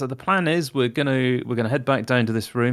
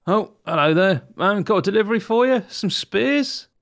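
a higher, lilting courier voice that climbs to a high questioning spears; cheery delivery man; 7 semitones higher, 3 dB louder than usual. Acted. Player's disguised courier greeting the torturers, breezy and higher-pitched. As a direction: play an innocent errand boy at the door.

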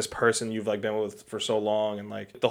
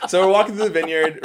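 laughing, high and loud; 6 semitones higher, 5 dB louder than usual. Real, not acted. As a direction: crack up while starting your story. Cracking up while starting story.

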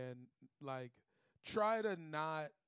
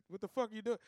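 higher, louder, retold demand from a father; lightly voiced dad; 8 semitones higher, 6 dB louder, faster than usual. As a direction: confront him like a baffled dad. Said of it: "And then my dad just".

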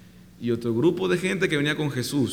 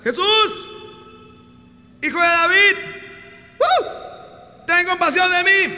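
high, loud cry for help; desperate shout; 3 dB louder, slower than usual. Retold or imitated. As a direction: call out over the crowd. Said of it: "Y empezó a gritar". (grito desesperado y agudo)

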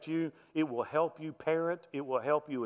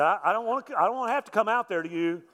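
raised, louder voice of a pretend taxman; mock threatening; 7 semitones higher, 5 dB louder, faster than usual. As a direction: lean on them with a put-on official warning. Prank taxman threatening a visit.

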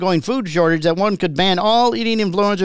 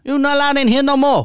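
put-on higher owner voice with a Chinese accent; 6 semitones higher than usual. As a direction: throw him out in a Chinese accent.

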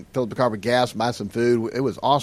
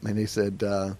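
ordinary talk, nothing marked

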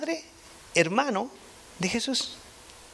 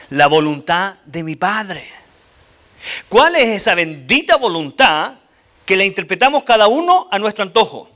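preaching emphasis; 3 semitones higher, 6 dB louder than usual. Real, not acted. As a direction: drive the question home to the congregation. Preaching climax, rising intensity. (predicación enfática y fuerte)

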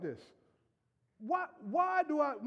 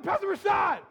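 louder, higher excited call; 7 semitones higher, 9 dB louder than usual. Retold or imitated. Jeremiah greeting the pastor, loud call. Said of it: "Jeremiah was like".

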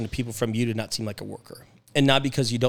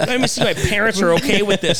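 high, louder, laughing mock kid; 9 semitones higher, 8 dB louder than usual. Host mocking a worker who asks his parents first, laughing. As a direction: laugh while playing a mama's boy.

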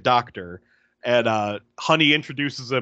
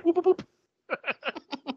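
high boops trailing away softer; imitated booping; 4 dB quieter, much slower than usual. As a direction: echo his silly high booping. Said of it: "Yeah, he's like".

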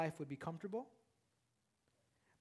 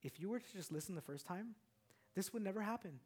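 soft tender father; 12 dB quieter than usual. Retold or imitated. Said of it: "I said".

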